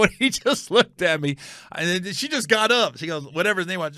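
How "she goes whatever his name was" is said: ordinary talk, nothing marked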